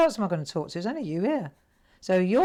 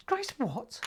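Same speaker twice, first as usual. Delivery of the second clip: higher, disbelieving; startled question; 9 semitones higher, 4 dB quieter than usual. Retold or imitated. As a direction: ask it in sudden disbelief.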